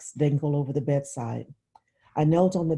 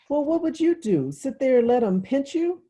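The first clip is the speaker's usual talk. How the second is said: put-on high, loud girlish challenge; young girl's sharp indignation; indignant little girl; 11 semitones higher, 5 dB louder than usual. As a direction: scold her sister for giving in. Claudia challenging her sister.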